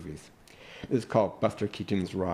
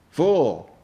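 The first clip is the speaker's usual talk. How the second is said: loud, higher shout of fool; shouted insult; 7 semitones higher, 8 dB louder than usual. Acted. An unseen voice yelling at Buster Keaton. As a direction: bark the word at him. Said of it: "A voice".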